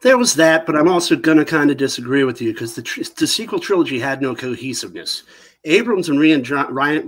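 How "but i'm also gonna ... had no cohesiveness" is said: ordinary talk, nothing marked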